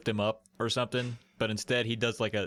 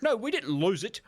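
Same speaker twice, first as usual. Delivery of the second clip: higher, mock Viking islander; a hammed-up Viking accent, higher voice; weird Viking accent; 14 semitones higher than usual. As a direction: play an annoyed Polynesian in a strange Viking accent. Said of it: "weird Viking kind of accent".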